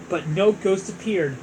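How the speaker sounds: plain reading voice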